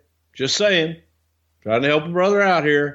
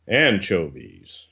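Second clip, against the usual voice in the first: normal talking voice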